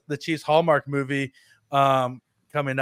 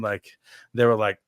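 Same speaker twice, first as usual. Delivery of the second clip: ordinary talk, nothing marked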